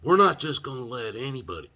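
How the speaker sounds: loud, high parroting of the jailer; mock indignation at being refused jail; mocking repeat. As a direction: throw the jailer's line back, loud and high. Jailer quoted in disbelief.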